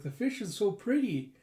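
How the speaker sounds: put-on high, clearly spoken kid gushing; eager little-girl voice; excited child. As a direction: gush excitedly in clear words. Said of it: "Anya getting so excited".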